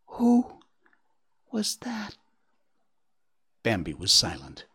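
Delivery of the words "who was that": very high, small voice fading to a whisper; tiny awestruck echo; barely audible. A narrator's reading. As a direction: squeak it out, almost too timid to be heard. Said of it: "voice that could hardly be heard".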